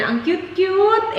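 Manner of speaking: high, slow gush over something cute. Real, not acted. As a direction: coo like it's adorable. Gushing over her bamboo display. (kinikilig na boses)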